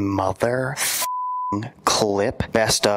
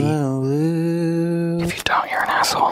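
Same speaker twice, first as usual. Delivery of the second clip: whisper; 5 semitones higher than usual. Acted. Whispered mock plea.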